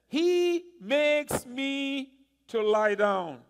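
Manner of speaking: very slow, loud and drawn-out scripture line; slow weighty quote. Acted. Pastor quotes David to his congregation, slow and weighty. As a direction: lean on each word like a proclamation.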